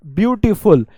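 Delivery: ब्यूटीफुल in clearly separated beats; pause between syllables. Shown as a demonstration. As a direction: say ब्यूटीफुल as 'byoo-tee-ful', measured. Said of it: "आप pause लेंगे".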